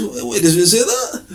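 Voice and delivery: high, laughing disbelief; shocked disbelief. Retold or imitated. Shocked listener asks the preacher in high laughing disbelief. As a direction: stop him in startled disbelief.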